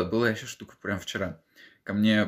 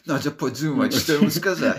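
laughing; 6 semitones higher, 7 dB louder than usual. Real, not acted. Mocks own flustered self.

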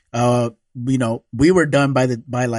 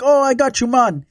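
high, bright bro greeting, much higher; bartender voice; 10 semitones higher than usual. Retold or imitated. Bartender greeting the groom at the bar, high and upbeat. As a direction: play the friendly bartender, pitched way up. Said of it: "they're just like".

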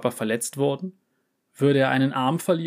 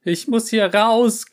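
high, loud panicked shriek; trapped cat shrieking in panic; 10 semitones higher, 6 dB louder, slower than usual. Acted. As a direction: shriek to get out. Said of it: "kreischte Miauzka".

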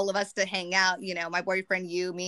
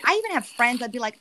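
usual speaking voice

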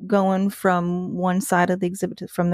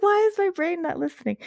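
high-pitched self-quote; 10 semitones higher than usual. Retold or imitated. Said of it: "I was like".

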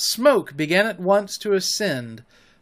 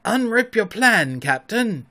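cried eagerly; 5 semitones higher, 4 dB louder than usual. A narrator's reading. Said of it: "he cried eagerly".

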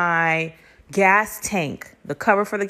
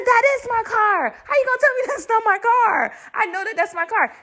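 very high, outraged protest; escalated indignation. Retold or imitated. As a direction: push the pitch way up in disbelief. Argument with father escalates.

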